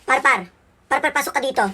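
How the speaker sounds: put-on loud summons to a child; calling out. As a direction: call the child into the room. Mother calls child into room.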